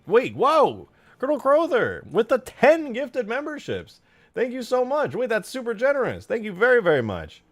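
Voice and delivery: high, loud, stunned gratitude; blown-away streamer yelling thanks; amazed excitement. Real, not acted. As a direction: shout your thanks like you can't believe the gift. Stunned by ten gifted memberships.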